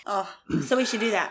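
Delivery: normal talking voice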